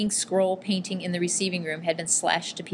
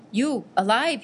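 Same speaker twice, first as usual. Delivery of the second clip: higher, rising amazed exclamation; astonished gasp; 5 semitones higher, 4 dB louder, slower than usual. A narrator's reading. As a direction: marvel that a friend survived. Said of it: "he gasped".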